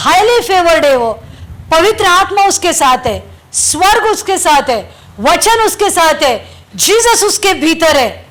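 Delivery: loud, high, driving sermon voice; fervent preaching. Acted. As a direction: preach it out to the whole church with fire. Preaching at full force. (जोशीला, ऊँचा, ज़ोरदार प्रवचन)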